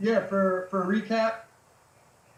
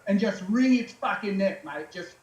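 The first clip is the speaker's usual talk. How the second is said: put-on Australian accent; 3 semitones higher than usual. Aussie riding coach telling a new rider where to sit, in an Australian accent. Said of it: "you do a really good Australian accent".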